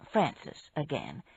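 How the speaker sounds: ordinary delivery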